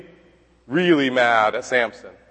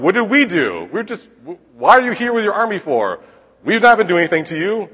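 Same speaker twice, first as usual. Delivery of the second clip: bewildered protest; 5 semitones higher, 4 dB louder than usual. Retold or imitated. Townspeople protesting to the army.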